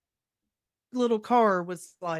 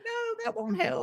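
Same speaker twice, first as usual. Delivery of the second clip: much higher, whiny protest; whiny friend; 12 semitones higher, 4 dB quieter than usual. Retold or imitated. Dazed friend rejects useless advice, high whiny no. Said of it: "And she's like".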